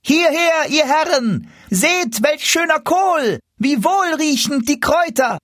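put-on high-pitched market boy's cry; boy calling out. A narrator's reading. Boy calls out his mother's vegetables to market customers, high-pitched calling. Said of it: "So rief der Knabe".

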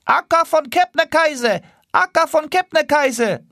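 high calling goose voice, hailing. Acted. Rear geese calling to the lead goose, high call. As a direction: call ahead to the leader.

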